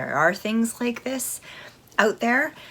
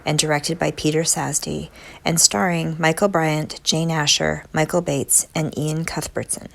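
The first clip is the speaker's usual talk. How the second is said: louder trailer voice over music; announcer read; 7 dB louder than usual. Acted. Reads synopsis over music.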